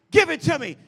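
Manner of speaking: loud divine demand; God calling. Acted. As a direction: shout it as God's call. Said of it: "He's like".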